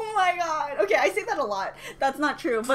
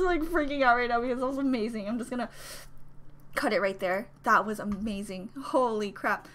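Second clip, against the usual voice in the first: ordinary talk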